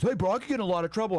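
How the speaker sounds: high, fast whiny excuse; imitating the accomplice's whining excuse; mock whiny plea. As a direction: whine it like a scared accomplice. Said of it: "and he goes".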